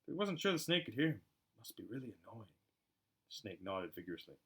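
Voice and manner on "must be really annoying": murmur. Acted. Harry sympathising with snake.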